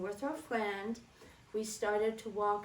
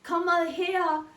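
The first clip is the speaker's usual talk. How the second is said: high, louder call to Heinz in the back; calling her husband; 8 semitones higher, 7 dB louder, much slower than usual. Retold or imitated. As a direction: call out to the kitchen. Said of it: "And then she'd say".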